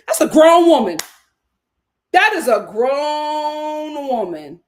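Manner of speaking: emphatic, with GROWN stretched out long; exasperated insistence. Real, not acted. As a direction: raise the voice and hang on the word "grown". Exasperated rebuttal of the babying.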